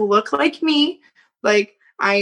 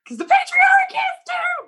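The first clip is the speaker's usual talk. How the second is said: very high, yelled outburst; mock defiant shout. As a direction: holler it as a comic righteous outburst. Retold outburst to brother, shouted.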